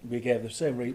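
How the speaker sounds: ordinary delivery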